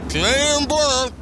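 talking with a mouthful of food; words mumbled around a mouthful; mouth full. Real, not acted. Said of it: "don't talk with your mouth full".

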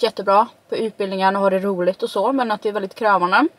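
fingers touching lips; talking behind fingers; hand partly over mouth. Real, not acted. Seen 4: Fingers rest at her lips from 2.1s; not before.

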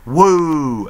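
high wrestler's "woo"; Charlotte. Retold or imitated. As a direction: attempt a high, drawn-out woo. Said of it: "I couldn't do the woo properly".

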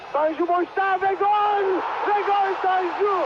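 excited goal call, slow and stretched; goal shout. Real, not acted. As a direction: yell the goal with joy. Goal call in live commentary. (coşkulu gol bağırışı)

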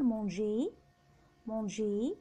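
"مونجي" ending in é, r silent; "مونجي" twice, closing on "ay". Shown as a demonstration. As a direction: say French "مونجي", manger, without final r. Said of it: "ألف مقصورة".

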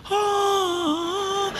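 long, high blissful "aah"; sustained high mock-serene note; new age "ah". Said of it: "kind of a new age moment".

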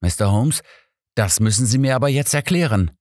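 mild rebuke from the inspector; reprimanding. Acted. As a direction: tell him off, wanting answers now. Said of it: "rüffelte Inspektor Woolford Holmes".

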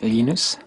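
"Linus" as LEE-noos, Swedish style; Swedish pronunciation. Shown as a demonstration. Programmer showing how to say his name, "Linus" the Swedish way. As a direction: Swedish "Linus", long ee and rising end. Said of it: "when I speak Swedish".

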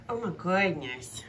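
normal talking voice

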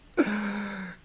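a real, high, choked little laugh; teary laugh. Real, not acted. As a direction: laugh through the tears. Emotional laugh after tearing up.

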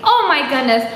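usual speaking voice

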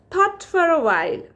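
usual speaking voice

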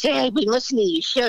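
fast, high New York cabbie voice; cliché fifties-movie New York cab driver; New York accent. Retold or imitated. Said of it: "I did a New York accent".

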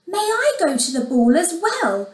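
put-on wishful young heroine; hopeful. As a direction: ask longingly to be allowed. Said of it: "Cinderella asked hopefully".

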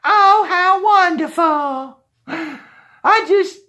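very high, slow, gushing mock pastor; pastor's falsetto delight, hammed up. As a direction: gush like a flattered pastor. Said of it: "he's gonna say".

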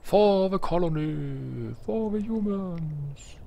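slow, high, drawn-out battle cry in English; solemn parody of a soldier's pledge; hammed-up soldier salute, slow; mock heroic motto.

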